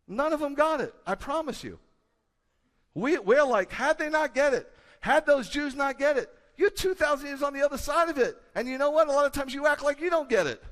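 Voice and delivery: fiery preaching shout at the congregation; preaching at full force. Real, not acted. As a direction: hammer the point home to the church.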